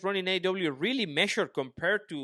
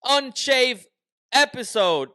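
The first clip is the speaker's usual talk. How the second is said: high, drawn-out podcast intro; show-opening announcer; 11 semitones higher, 5 dB louder, slower than usual. Acted. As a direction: announce the show big, pitched up and slow.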